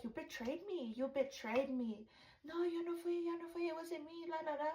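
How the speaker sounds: high, sing-song taunting denial; mocking denial. Mocking the denial, retold argument.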